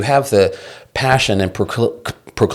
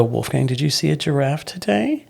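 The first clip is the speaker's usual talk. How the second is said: put-on higher, sing-song question to a little child; imitated parent-to-toddler; 6 semitones higher, 3 dB quieter than usual. Father testing toddler with silly question, child-directed voice. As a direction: ask a toddler sweetly, pitch lifted.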